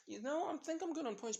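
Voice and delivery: high, breezy character voice; casual brush-off. A narrator's reading. Character about to walk off.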